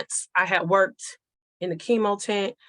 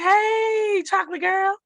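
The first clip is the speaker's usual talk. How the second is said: imitating daddy's bright, much higher loud hello; cheerful greeting; 13 semitones higher, 4 dB louder, slower than usual. Daughter imitating her father greeting her, much higher excited call. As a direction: call out the greeting like a happy dad.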